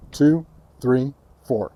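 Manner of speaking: ordinary talk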